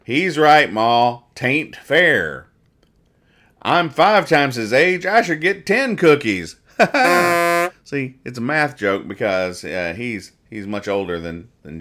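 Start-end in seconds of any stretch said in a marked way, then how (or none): 0.0-6.6 loud comic-strip rustic, slowing and climbing higher; Snuffy Smith. Acted. Snuffy telling Ma the cookie share ain't fair, loud character voice. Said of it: "And then Snuffy goes". 6.8-7.7 loud, high burst of laughter. Real, not acted. Comic reader laughing at the cookie punchline, loud high laugh.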